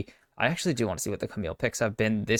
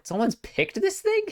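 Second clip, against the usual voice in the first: can't believe anyone picked it; shocked disbelief. Real, not acted. Caster amazed someone actually picked Elise, voice jumping up. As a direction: voice leaps high in amazement.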